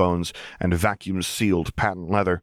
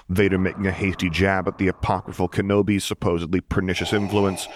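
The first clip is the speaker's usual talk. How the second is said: usual speaking voice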